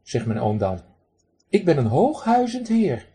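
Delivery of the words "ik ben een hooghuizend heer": duck mimics his uncle, high and posh. Duck imitates his pompous uncle to the man, posh. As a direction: put on snooty airs. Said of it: "zo praat mijn oom".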